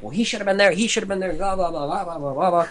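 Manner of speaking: high whiny mock excuse voice; high, petulant excuse-making; mocking whiny streamer. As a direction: whine like a streamer blaming his team.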